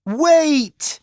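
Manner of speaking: high urgent call; shouting wait to a departing boy. A narrator's reading. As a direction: call after someone leaving. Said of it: "shouted Ellie".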